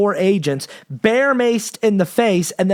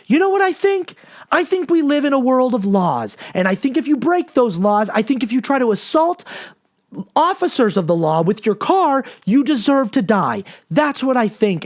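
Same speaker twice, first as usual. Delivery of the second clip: retold screaming; 5 semitones higher than usual. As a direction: burst out yelling as the calm act cracks. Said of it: "he starts screaming and he goes".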